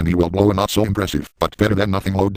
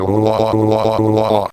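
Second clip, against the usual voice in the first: exaggerated louder mock moans; mock moaning; 3 dB louder than usual. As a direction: moan it out loud as a joke. Moaning while using the dildo.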